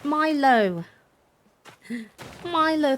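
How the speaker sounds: high, loud excited call. Real, not acted. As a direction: cheer the name out. Calling out new subscriber.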